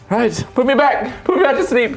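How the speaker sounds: pleading high to be put back; whiny, begging to sleep again; high pleading whine. Acted. GM voices Lucius begging.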